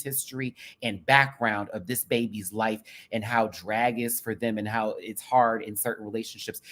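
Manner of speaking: ordinary talk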